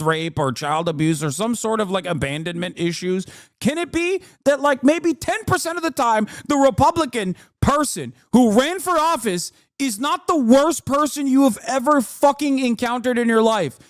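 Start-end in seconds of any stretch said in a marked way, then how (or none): 3.6-13.8 pitched way up in disbelief; high exasperated plea. Real, not acted. Streamer ranting at Republicans, high and incredulous. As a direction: plead upward, incredulous and fed up.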